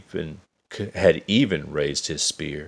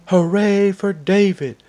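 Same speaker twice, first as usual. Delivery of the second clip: very high loud cheer, slowing at the end; cheering crowd; 13 semitones higher, 6 dB louder, slower than usual. A narrator's reading. Soldiers hailing David the giant slayer, high loud cheer. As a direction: cheer him like a hero. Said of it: "they all cried".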